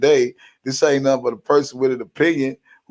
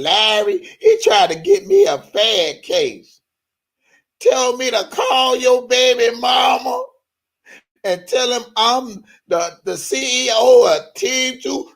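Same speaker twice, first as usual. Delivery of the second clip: much higher, slowed mock outrage; mocking imitation; 9 semitones higher than usual. Mocking a rival who called about a case, high mock outrage. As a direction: squeal it like an offended rival.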